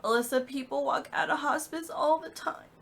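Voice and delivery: high, strained reassurance; tearful insistence. Retold or imitated. Sick mom looking at her daughter, desperate insistence. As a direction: insist on it as if holding back tears.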